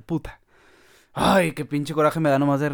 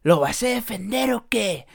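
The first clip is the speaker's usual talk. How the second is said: mock tough guy challenge, higher; 5 semitones higher, slower than usual. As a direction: sneer like a streetwise rapper. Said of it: "¿Esos vatos son gemelos o por qué hablan igual?".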